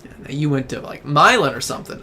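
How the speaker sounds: usual speaking voice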